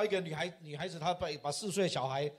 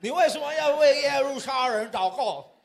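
higher, louder mimicked question; challenging rabbi; 5 semitones higher, 6 dB louder than usual. Interpreter relays the rabbi's question to the Gentile, challenging. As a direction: echo the rabbi's indignant challenge.

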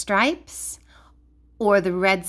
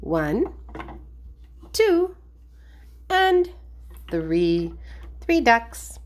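ordinary talk, nothing marked

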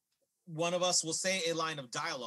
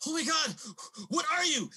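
high, loud alarmed cry; panicked; 10 semitones higher, 3 dB louder than usual. Acted. David reacting to the swelling old man, panicked. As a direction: gasp it out high in horrified shock.

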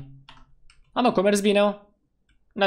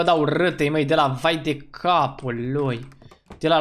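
usual speaking voice

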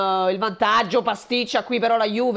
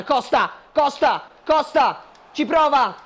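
excited commentary; 6 semitones higher, 4 dB louder, slower than usual. Acted. FIFA goal chance commentary.

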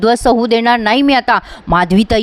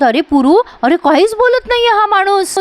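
exasperated mother; 9 semitones higher than usual. Acted.

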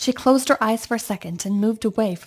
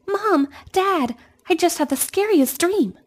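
much higher, louder call; excited shout; 9 semitones higher, 4 dB louder than usual. Acted. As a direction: burst in calling to parents. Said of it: "Amanda shouted".

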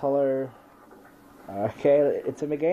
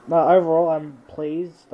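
excited; 5 semitones higher, 10 dB louder than usual. Real, not acted. Wrapping up the pack opening.

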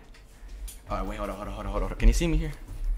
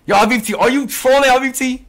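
loud, higher call-out; 10 semitones higher, 12 dB louder than usual. Real, not acted. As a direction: call out the troll. Calling out a troll.

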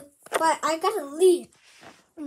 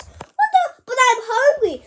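indignant protest; 5 semitones higher, 9 dB louder, slower than usual. Acted. Child objects to teacher.

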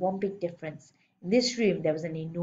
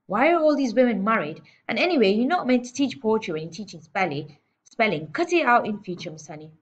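loud, raised-pitch telling-off; domineering headmistress, scornful; 3 semitones higher, 6 dB louder than usual. Acted. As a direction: lay down the law to a junior teacher.

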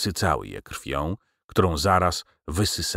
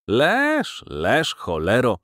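impatiently; 5 semitones higher, much slower than usual. A narrator's reading. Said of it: "dodał niecierpliwie".

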